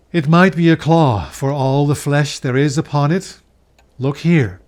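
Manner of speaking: a stern spirit bidding him look; grim ghost. Acted.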